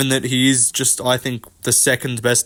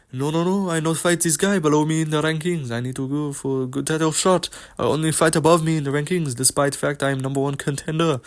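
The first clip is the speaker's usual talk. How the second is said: quieter imitation of a fighter in a mock French accent; lightly done mock French accent, a bit softer; 3 dB quieter than usual. Mocking a fighter on a podcast in a mock French accent. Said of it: "that's the Cyril Gane special".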